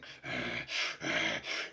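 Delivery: heavy furious huffs; seething breaths. Acted. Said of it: "the dwarf is just fucking seething".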